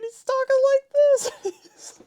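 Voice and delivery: squeaky mock of a laughing talker; imitating high-pitched voice. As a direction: slide up into a squeal. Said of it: "his voice gets all high-pitched".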